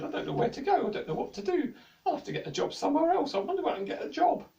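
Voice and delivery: high, fast fretting; the jester fretting alone in the forest; forlorn jester. A narrator's reading. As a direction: worry aloud, hurrying.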